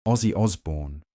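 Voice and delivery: ordinary delivery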